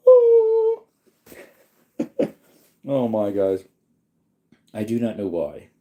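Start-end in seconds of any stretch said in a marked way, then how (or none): 0.1-0.8 a high, loud cry of a yawn; a big yawn breaking through. Real, not acted. Yawn overtakes streamer.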